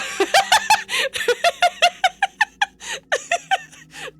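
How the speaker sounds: high wheezing laugh; shrieking laughter. Real, not acted. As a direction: laugh until it squeaks. Laughing at own joke.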